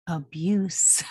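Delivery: breathy whispered abuse; whispered word. Real, not acted. Complaining about forced Social Security numbers, a whispered word.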